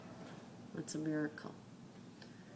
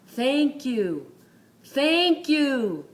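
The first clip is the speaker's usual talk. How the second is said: shouted, higher and louder; 9 semitones higher, 10 dB louder, much slower than usual. Retold or imitated. Injured rider yelling thanks to his helper, loud shout. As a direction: call out your thanks across the crash scene. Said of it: "in his loudest voice".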